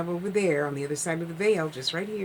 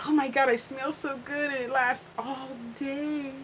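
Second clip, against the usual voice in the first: delighted sister; 8 semitones higher than usual. Retold or imitated.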